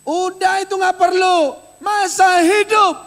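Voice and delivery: loud, high pulpit proclamation; preacher booming the promise at the church; fiery preaching. Acted. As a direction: thunder the promise to the congregation. Preaching at full force.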